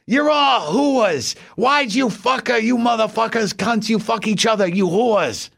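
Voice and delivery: put-on high, shrieking curses from an old woman; high, slow shrieked rant in a little old lady's voice; old lady screaming. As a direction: scream like a furious old lady alone at home. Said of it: "on a tirade".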